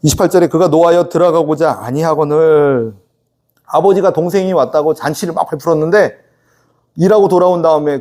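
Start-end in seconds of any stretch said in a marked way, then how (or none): none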